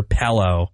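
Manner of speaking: "Pello" with an eh in the first syllable; "pillow" as "Pello". Retold or imitated. Host imitates sister's word for pillow, "Pello" with eh. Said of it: "my sister, Pello".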